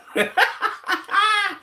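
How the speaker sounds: high-pitched laugh. Real, not acted. Laughing after impression.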